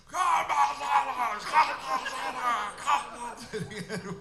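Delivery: high, slow shouts that fade into a laugh; screaming announcer. Retold or imitated. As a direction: chant the name like a caller who can't stop. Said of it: "the guy just can't stop".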